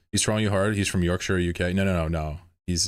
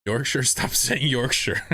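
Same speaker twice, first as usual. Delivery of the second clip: slow, laughing "stop saying Yorkshire" with a higher pitch; laughing plea; 5 semitones higher, slower than usual. Real, not acted. Streamer laughing as he begs chat to drop Yorkshire. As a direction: laugh through a pleading "Yorkshire".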